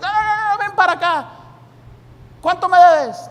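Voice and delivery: high, loud hearty call; hammed-up cheerful creditor; eager creditor. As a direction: wave the debtor over, loud. Acted creditor collects debt.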